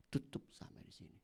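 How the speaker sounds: hushed whisper. Real, not acted. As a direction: drop to a whisper for emphasis. Quiet emphasis on closing point.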